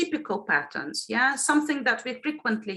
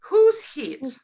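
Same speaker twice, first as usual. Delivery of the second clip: "Who's" hit higher and louder; stressed prompt question; 4 semitones higher, 5 dB louder than usual. Shown as a demonstration. Teacher hinting where the stress is, stress on WHO'S. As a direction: punch "Who's", then let "hit" drop. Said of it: "where is the stress".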